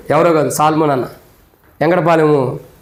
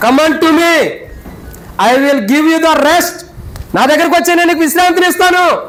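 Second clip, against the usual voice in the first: raised, impassioned pulpit voice, driving each question home; preaching at full force; 11 semitones higher, slower than usual. Acted. Pastor to funeral congregation, preaching at full force.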